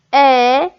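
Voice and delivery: "épingle" in three separate beats, é, pin, gle; split into syllables. Shown as a demonstration. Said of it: "Dans quelle syllabe on entend un". (« épingle » découpé en syllabes : é… pin… gle)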